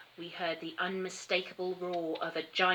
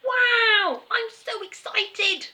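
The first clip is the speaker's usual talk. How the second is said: much higher and louder, slowed on excited; 8 dB louder, much slower than usual. A narrator's reading. Terry hearing he won, high excited gush. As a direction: gush with childish delight. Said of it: "said Terry".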